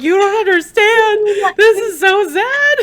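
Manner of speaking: loud, high-pitched mock wail; hammed-up sobbing protest, drawn out at the end; retold crying. Wife ranting to her husband about the book, mock wail.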